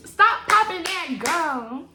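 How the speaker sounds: slow, stretched "popping" with a rise; drawn-out sassy quote. Retold or imitated. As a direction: drag out the word popping. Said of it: "Girl, get that".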